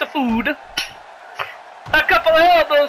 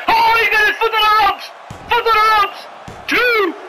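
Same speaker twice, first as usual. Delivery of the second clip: higher-pitched, urgent ringside cry; excited match call; 4 semitones higher than usual. Acted. Commentator spots foot on the ropes, excited. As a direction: cry it out as the pin breaks.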